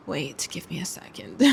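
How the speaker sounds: low whisper to oneself; whispered aside. Real, not acted. Vlogger asking viewers to wait a second, whispered aside. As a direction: whisper it under the breath. Muttering while checking on screen.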